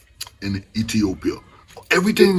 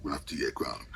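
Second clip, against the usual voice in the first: whisper; 10 semitones lower, 15 dB quieter than usual. Real, not acted. Drops to a whisper.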